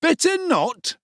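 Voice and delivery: sneering high exclaim as the bully Noah. A narrator's reading. Bully Noah mocks Oliver's warning, sneering exclaim. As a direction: throw the words back mockingly. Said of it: "exclaimed Noah".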